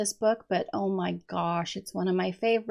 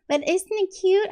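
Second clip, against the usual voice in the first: cutesy gush; 10 semitones higher, 5 dB louder than usual. Real, not acted. Gushing over her finished page.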